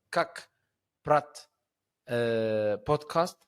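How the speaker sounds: a light South African accent on the show's name. Shown as a demonstration. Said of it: "I try to say it with my best S A accent ever".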